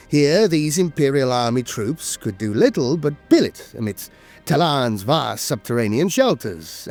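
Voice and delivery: usual speaking voice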